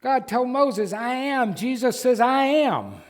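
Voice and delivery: preacher's climax, high and loud; preaching at full force. Real, not acted. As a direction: hammer "I am." twice from the pulpit. Climactic parallel, preaching hard.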